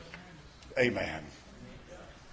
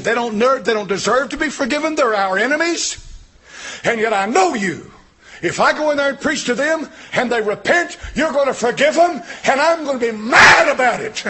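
indignant preaching; 7 semitones higher, 7 dB louder than usual. Retold or imitated. Preacher voices angry Jonah loudly.

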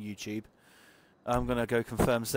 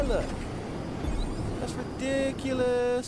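exasperated; 12 semitones higher, slower than usual. Real, not acted. Car veering off road.

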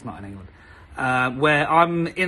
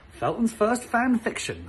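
announcing; 6 semitones higher than usual. Shown as a demonstration. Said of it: "drum roll please".

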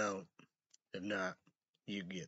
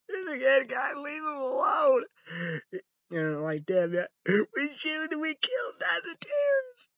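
mock high squeal; 6 dB louder than usual. Mock outraged rant.

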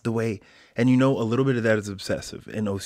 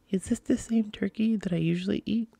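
high put-on voice of the son, asking timidly; mock kid voice; 12 semitones higher than usual. Dad imitating his son asking about the turkey, high kid voice. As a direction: play the kid, pitched way up and small.

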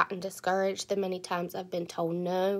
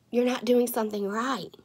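ordinary talk

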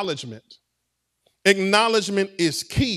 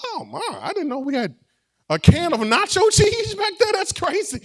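mock surprise; 9 semitones higher than usual. Imitating finding nacho cheese.

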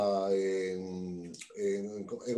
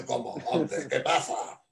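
put-on Spanish accent. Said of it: "el mismo español a quien lo has imitado".

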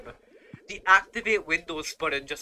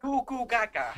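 put-on baby talk; 5 semitones higher than usual.

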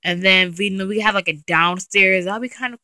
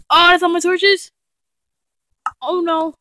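startled; 10 semitones higher, 6 dB louder than usual. Real, not acted. Panics in dark cave.

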